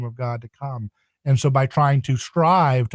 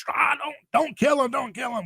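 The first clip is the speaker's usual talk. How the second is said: frantic plea; 12 semitones higher than usual. Retold or imitated. Peter pleading, acted quote.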